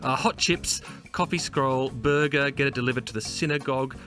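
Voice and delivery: ordinary talk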